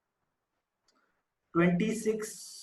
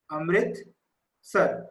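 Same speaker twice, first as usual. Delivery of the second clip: Amritsar drawn out very slowly; slow dictation; 3 semitones lower, much slower than usual. Shown as a demonstration. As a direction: dictate the name part by part, unhurried. Repeats city name for writing.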